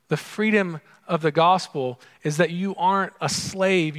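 normal talking voice

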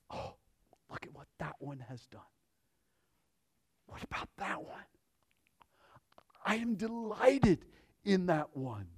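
hushed, delighted whisper of a proud father. Pretend. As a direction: whisper it like a father beaming over his child. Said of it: "He goes".

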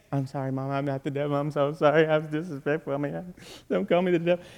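hushed, hurried mock pleading; meek apology. Preacher replays apologizing to mama, meek and scared. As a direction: plead small and soft, like a scolded boy.

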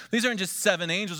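usual speaking voice

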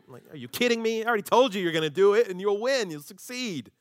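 loud, fast, incredulous; are you kidding me, fed up; exasperated. Acted. God exasperated with hesitant Moses, loud and quick.

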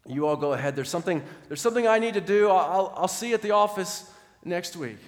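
loud, high and fast shout to his buddies; shouting to friends. Acted. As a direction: call ahead to friends, hurried. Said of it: "And so he shouted to the".

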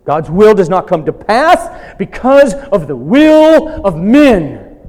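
loud, high, slow preaching build; forceful preaching. Acted. Pastor driving home God's sovereignty to the congregation, loud preaching. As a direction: proclaim God's control with rising force.

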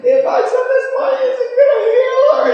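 high, wailing mock sob; fake crying. Prank caller fakes tears to the TV hotline, wailing. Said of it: "I fake crying on there".

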